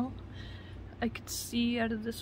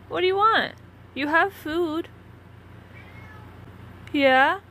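high, loud call to a pet; 8 semitones higher, 5 dB louder than usual. Real, not acted. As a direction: coax the cat over in a high loud voice. Calling the cat to come.

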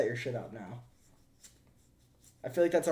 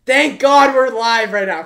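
much higher, louder and slow; loud relieved shout; 9 semitones higher, 16 dB louder than usual. Real, not acted. Streamer shouting to chat, glad they're live. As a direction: holler it out with relief.

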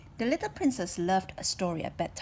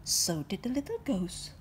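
ordinary delivery, nothing marked

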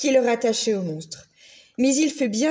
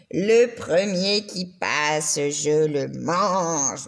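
lower, very slow, growling monster voice; sinister monster; 7 semitones lower, much slower than usual. A narrator's reading. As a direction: drag it out low and patient like a hungry beast. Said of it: "il continuait à attendre et à dire".

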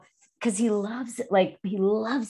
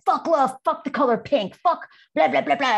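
mock tough defiance; 6 semitones higher, 3 dB louder than usual. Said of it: "a very toxically masculine woman".

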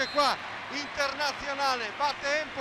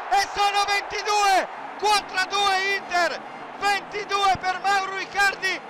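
shouted, higher and louder goal call; breathless italian goal commentary; 6 semitones higher, 5 dB louder than usual. Real, not acted. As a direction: roar the goal and the record. Goal call, scoring record.

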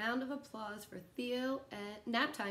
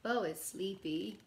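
slow, gentle storybook read with long pauses; reading to children; 2 semitones higher than usual. Acted. Teacher reading a bedtime picture book to kids, slow and gentle. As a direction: lull the little ones toward nap time.

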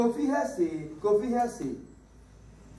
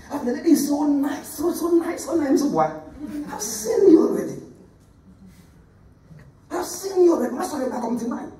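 higher, gushing mock-flattery of the lady; mock flatterer; 6 semitones higher than usual. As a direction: parody the sweet talker, pitched up.

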